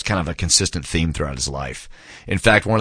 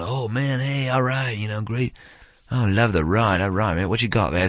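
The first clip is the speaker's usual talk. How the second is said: impressed rock stars; 3 semitones higher, 4 dB quieter than usual. Retold or imitated. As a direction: imitate stars gawking at a Ferrari. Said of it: "they're all going".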